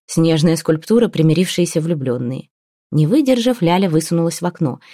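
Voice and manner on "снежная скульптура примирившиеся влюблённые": ordinary delivery, nothing marked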